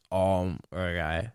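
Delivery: dismissive. Retold or imitated. Mocking dismissive tester.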